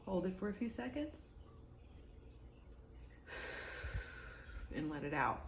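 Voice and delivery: soft guided breathing. Shown as a demonstration. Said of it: "I take a minute, I close my eyes".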